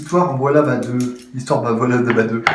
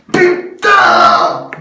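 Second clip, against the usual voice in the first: high, loud swear of frustration; frustrated shout; 12 semitones higher, 6 dB louder than usual. Real, not acted. Fumbling a repair on camera, a loud high frustrated yell. DIY repair goes wrong.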